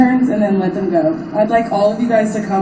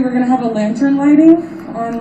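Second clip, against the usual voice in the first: ordinary talk, nothing marked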